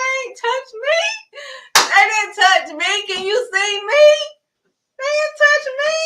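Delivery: Daniel crying out he's untouched; triumphant shout. Acted. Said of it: "He was like".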